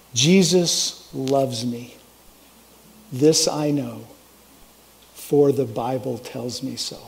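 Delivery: imitating a Swiss German professor, slow and low; Swiss German accent. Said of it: "in his thick Swiss German accent".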